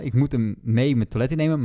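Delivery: ordinary talk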